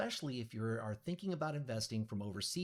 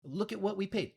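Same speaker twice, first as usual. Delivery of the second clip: much higher and louder, a hammed-up Brazilian accent that slips into New Yorker; botched Brazilian accent, pitched way up and pushed loud; failed Brazilian accent; 13 semitones higher, 9 dB louder than usual. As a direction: play the pleading client in a clumsy Brazilian-turned-New-York accent, high and loud. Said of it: "that was almost like a New Yorker accent".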